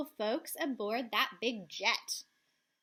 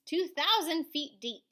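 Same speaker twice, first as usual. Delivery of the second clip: plain reading voice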